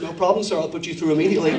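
low, obliging American operator; smooth American telephone voice. Acted. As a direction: connect the call in American accent. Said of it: "change your accent and you were there".

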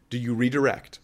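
ordinary delivery

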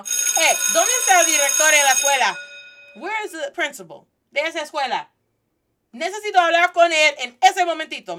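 loud, high, fast parent on the warpath; angry mama. Acted. Angry mama coming into school demanding the principal, irate shout. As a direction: storm into the office demanding to be heard. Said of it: "a mama's coming into school right now".

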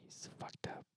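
hushed conspiratorial whisper; worried whisper. Pretend. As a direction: whisper it so he can't hear. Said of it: "Why are we whispering?".

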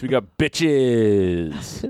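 very slow, stretched punchline; drawn out. Acted. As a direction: stretch every word.

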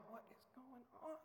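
a high, hushed, baffled question; bewildered son. Retold or imitated. Son bewildered as his father hugs him, hushed and baffled. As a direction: caught off guard by the hug, asking in disbelief. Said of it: "he's not expecting this".

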